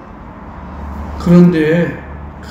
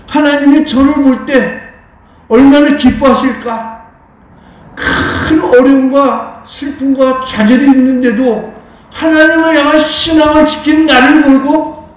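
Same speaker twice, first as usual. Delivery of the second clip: raised, high-pitched sermon delivery; impassioned preaching; 6 semitones higher, 4 dB louder than usual. Real, not acted. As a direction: preach it out, stretching the weighty words.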